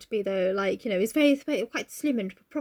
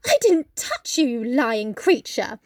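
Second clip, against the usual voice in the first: indignant denial; 4 dB louder, much slower than usual. Acted. Kathy denying she touched Nellie, mock indignation. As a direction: deny it hotly, drawing out the insult. Pinched servant accused, Kathy denies it.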